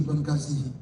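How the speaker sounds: ordinary talk, nothing marked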